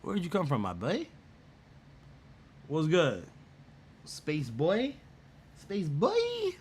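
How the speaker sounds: high, put-on greeting to a buddy; mock greeting voice. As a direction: squeak out a surprised hello.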